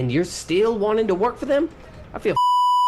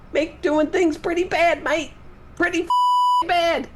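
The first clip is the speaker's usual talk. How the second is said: squeaky high complaining whine; 12 semitones higher, slower than usual. Acted. Jazzman exaggerates how bad it is.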